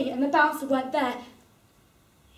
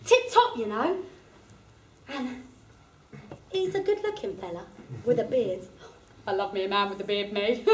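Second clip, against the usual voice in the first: usual speaking voice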